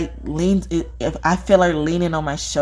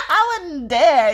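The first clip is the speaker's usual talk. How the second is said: slow, higher echo of the friend's line; repeating her friend; 10 semitones higher, 3 dB louder, much slower than usual. Retold or imitated. Speaker echoing her friend's refusal, slow and higher. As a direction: drag it out mockingly. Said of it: "she was like".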